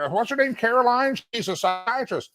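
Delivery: high-pitched mock of a clueless host; fumbling radio host. Host mimicking a colleague botching the guest's introduction, mock fumbling. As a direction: imitate a host groping for the name. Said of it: "But no, he's like".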